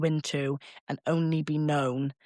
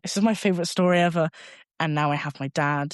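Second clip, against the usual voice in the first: ordinary talk, nothing marked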